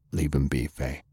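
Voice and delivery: low, soft and gentle; calm her down tenderly; steady older man quietly holding a girl back; gently. A narrator's reading. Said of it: "Lance said gently".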